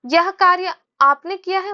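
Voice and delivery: high, loud emphasis on "कार्य"; stress on कार्य. Shown as a demonstration. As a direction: hit the word "कार्य" hard within the sentence. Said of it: "कार्य पे अगर जोर देंगे". ("कार्य" पर जोर देकर, ऊँचे स्वर में)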